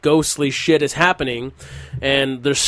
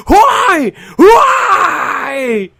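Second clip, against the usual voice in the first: very high, loud cry mixed with laughter; laughing mock wail; 14 semitones higher, 8 dB louder than usual. Real, not acted.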